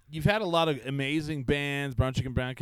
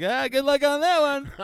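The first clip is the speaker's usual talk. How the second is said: mocking good luck; 11 semitones higher, 4 dB louder than usual. Retold taunt to neighbor.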